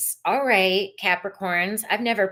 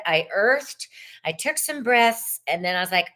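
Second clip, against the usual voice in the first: ordinary talk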